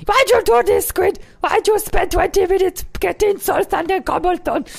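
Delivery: very high, silly complaining voice; mocking viewer impression. Player teasing his viewers about their complaints, mocking squeaky voice. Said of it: "You sound like this".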